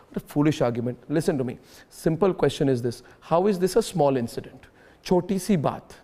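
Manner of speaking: pointed restatement. Real, not acted. Host pressing guest on a small incident, pointed restatement.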